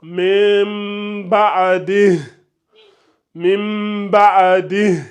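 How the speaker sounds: mim ba'di said slowly, stopping without the final hi; pausal form. Shown as a demonstration. Teacher showing the stop to Rebecca. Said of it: "si on s'arrête, ça donne".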